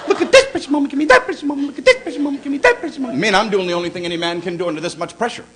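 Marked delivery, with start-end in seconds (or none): 0.1-3.2 high, breathless gushing, building; gushing wife. Retold or imitated. Wife gushing over precious moment dolls, high and excited. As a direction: gush over every doll like a giddy wife. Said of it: "My wife gets orgasmic".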